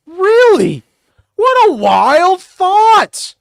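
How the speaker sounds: high, loud, hammed-up astonishment; sarcastic mock shock. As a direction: gasp it out in fake disbelief, mocking. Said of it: "I'll try to be quieter".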